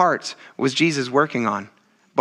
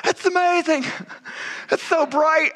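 high gushing mock-mom voice; imitating mom; 12 semitones higher than usual. Mom admiring the unlit Christmas tree, gushing mock voice. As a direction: gush like a proud mother. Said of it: "She'd be like".

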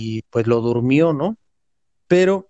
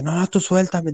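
mock agitated protest, pitched up; hermano alocado imitado; 6 semitones higher, slower than usual. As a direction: imitate a possessed man refusing a grip. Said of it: "se empezó a alocar otra vez". (protesta agitada imitada, en tono agudo)

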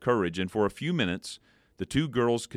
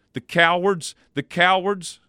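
sobbing Susan; 9 semitones higher, 6 dB louder than usual. A narrator's reading. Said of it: "sobbed Susan".

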